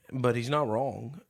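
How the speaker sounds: ordinary talk, nothing marked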